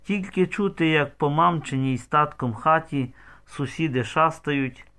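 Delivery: plain reading voice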